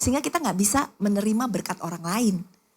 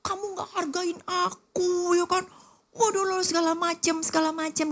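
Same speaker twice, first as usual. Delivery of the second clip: mock spouse quarrel; 9 semitones higher than usual.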